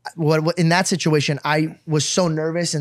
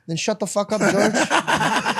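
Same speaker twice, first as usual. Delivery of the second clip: imitating dad; 2 semitones higher, much slower than usual. Dad's gruff reply, retold.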